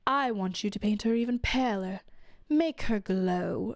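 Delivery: lightly voiced disdainful queen mother demanding a paler portrait; imitating the mother-in-law. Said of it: "mother-in-law comes and looks at it and is like".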